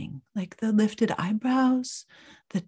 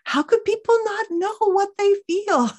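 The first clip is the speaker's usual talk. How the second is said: mock incredulous; 11 semitones higher, 4 dB louder than usual. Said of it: "you're probably thinking".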